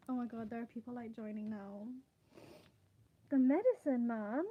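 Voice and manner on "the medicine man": louder, a startled cry of recognition; surprised outburst. Real, not acted. Streamer spotting a viewer joining, loud surprise. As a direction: blurt it out in surprise.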